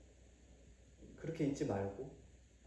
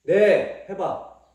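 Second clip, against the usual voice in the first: loud demand; 4 semitones higher, 13 dB louder, much slower than usual. Real, not acted. Parent demanding a clear answer.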